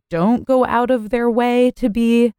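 rising uptalk on inclusive; upspeak. Real, not acted. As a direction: lift the end like a question. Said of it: "why did you just upspeak that".

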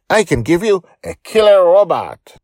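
high, loud, proud offer; proudly. Acted. Joy offering the party kids a robot, proud. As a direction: brag to win the kids over. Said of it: "she says proudly".

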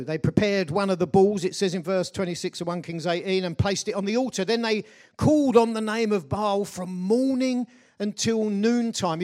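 ordinary delivery

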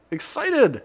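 sudden excited yelp; excited shout. Shown as a demonstration. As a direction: burst out excitedly. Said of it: "you know how I get excited".

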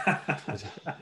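a quick amused chuckle; amused laugh. Real, not acted. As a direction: laugh off the teasing. Reacts to teasing accusation.

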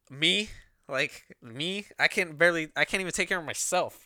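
loud, high-pitched retold indignation; upset protest. Speaker protesting to her in the dream, upset. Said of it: "more angry and more upset".

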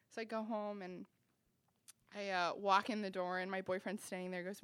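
ordinary delivery

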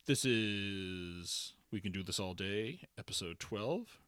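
slow announcer-style show opener; podcast intro. Acted. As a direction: announce the episode title.

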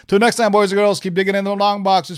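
rally the viewers as you wrap up; upbeat channel catchphrase goodbye; host sign-off. Acted.

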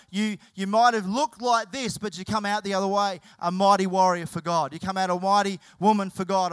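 loud, swelling call to the congregation; rousing preaching. Acted. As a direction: preach it out with full force. Preacher builds to rousing climax.

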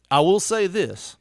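usual speaking voice

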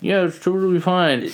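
fake cheerful. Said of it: "And I'm like".

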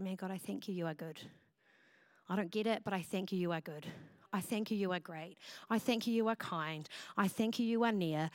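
barely voicing thanks through grief; grieving mother whispering thanks to God; weak and quiet voice. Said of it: "weak and quiet voice".

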